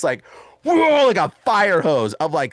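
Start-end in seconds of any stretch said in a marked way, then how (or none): 0.6-1.1 high, drawn-out gushing noise; imitated projectile vomiting. Man vomiting as the ring breaks, mock gush. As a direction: let it gush like a fire hose. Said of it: "projectile vomits".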